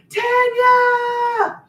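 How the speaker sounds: high, loud call of a name; excited shout to a viewer. Real, not acted. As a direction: shout across the room to get her attention.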